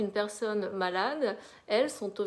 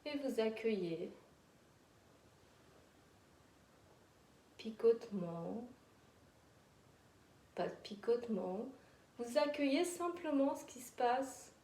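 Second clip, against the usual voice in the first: guided meditation; 6 dB quieter than usual. Acted. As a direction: lull them into calm. Guided relaxation.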